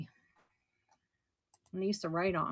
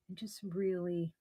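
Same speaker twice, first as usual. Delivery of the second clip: ordinary talk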